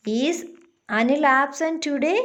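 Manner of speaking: "today" lifts up at the end; rising question tone. Shown as a demonstration. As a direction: read it as a question, voice climbing on "today". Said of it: "it's rising tone".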